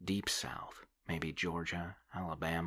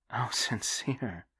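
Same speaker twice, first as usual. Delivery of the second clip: slow, higher, laughing the word sincere; soft laugh; 5 semitones higher, 4 dB louder, slower than usual. Acted. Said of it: "She laughed softly".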